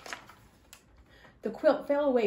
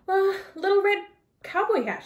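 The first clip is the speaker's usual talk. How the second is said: put-on little girl voice; 9 semitones higher, 3 dB louder than usual. Girl answers wolf nervously.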